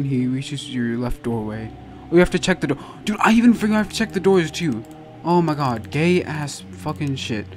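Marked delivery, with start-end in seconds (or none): none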